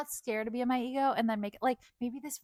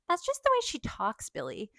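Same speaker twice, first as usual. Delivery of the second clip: dismissive owner; 6 semitones higher than usual. Retold or imitated. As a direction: brush it off like the boss.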